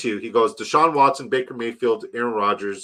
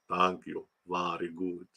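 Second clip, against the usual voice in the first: soft, low mock Russian accent; slow, hushed thanks in a put-on Russian accent; hammed-up quiet Russian accent; 5 semitones lower, 12 dB quieter, slower than usual. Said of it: "your Russian's fantastic".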